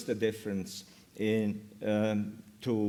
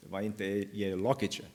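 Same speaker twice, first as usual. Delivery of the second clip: "elokice" stressed on its second syllable; 3 semitones lower, 5 dB quieter than usual. Shown as a demonstration. Lecturer quoting a dialect example, second syllable of "elokice" stressed. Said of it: "focus stress shifts onto the second syllable".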